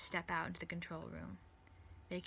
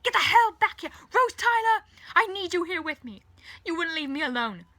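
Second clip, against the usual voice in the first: desperate, high-pitched shouting; full-force angry yell easing toward pleading; 12 semitones higher, 19 dB louder than usual. A narrator's reading. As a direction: yell up to someone leaving you. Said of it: "he yells above her".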